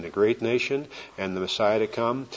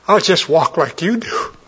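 hopeful cry; 6 semitones higher, 6 dB louder than usual. Retold or imitated.

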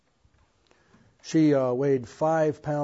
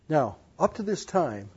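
normal talking voice

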